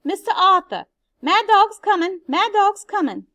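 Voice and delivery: high, loud warning shouts; shouting. Acted. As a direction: pound out the alarm to the neighbors. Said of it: "and she shouted".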